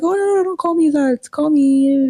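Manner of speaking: high-pitched, hurried waving-off of a formality; mock friendly protest. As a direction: imitate someone insisting on informality, pitched well up. Lecturer waves off formal title.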